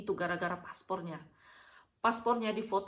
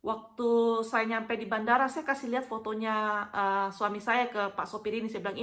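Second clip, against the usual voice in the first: normal talking voice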